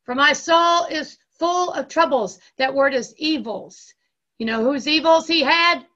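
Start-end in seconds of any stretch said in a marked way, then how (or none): 0.0-2.4 raised, loud declaimed lament; crying out. Acted. Jesus cries to the Father from hell, loud and raised. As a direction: proclaim it high and loud as a plea. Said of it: "still crying out".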